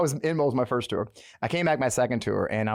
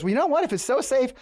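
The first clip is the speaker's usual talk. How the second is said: mock bold general; 9 semitones higher than usual. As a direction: call the bluff with a grin. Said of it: "Petraeus goes".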